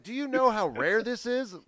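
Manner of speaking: mock-incredulous rhetorical question; indignant. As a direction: imitate an affronted owner of a treasure. Retelling Andy's indignant answer.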